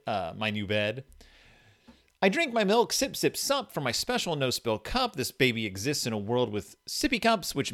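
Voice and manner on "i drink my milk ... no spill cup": high, sing-song storybook read; reading to a toddler. Acted. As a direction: read it like to a baby. Reads baby book aloud.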